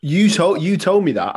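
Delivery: loud, high accusing tease; loud playful accusation; teasing call-out. Real, not acted. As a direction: call him out with a laugh. Teasing outburst at friend.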